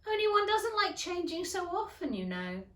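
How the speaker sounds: put-on bright girlish hurry; Alice voice. A narrator's reading. Alice explains.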